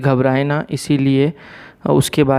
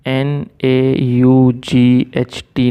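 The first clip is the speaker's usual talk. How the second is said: slow, letter by letter; spelling out; much slower than usual. Shown as a demonstration. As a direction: spell it out with a gap after each letter. Spelling out the word naught. (एक-एक अक्षर धीरे-धीरे बोलते हुए)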